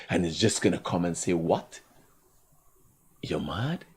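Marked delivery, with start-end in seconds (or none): 1.3-3.8 nice tone. Retold or imitated. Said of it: "In such a nice tone of voice".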